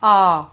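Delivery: आ held long and loud. Shown as a demonstration. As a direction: sound out आ long. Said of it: "आ की मात्रा ऐसे बोलते हैं".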